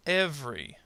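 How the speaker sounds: "Every." said as EV-ree; dropped middle syllable. Shown as a demonstration. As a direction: demonstrate "Every." in two syllables. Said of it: "schwa plus R here kind of disappears".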